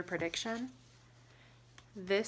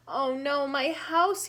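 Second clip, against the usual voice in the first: louder, high cry of dismay; mock yell; 5 semitones higher, 9 dB louder than usual. Beaver shouting as his house collapses, loud dismay. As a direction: wail for the lost house. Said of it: "yelled Beaver".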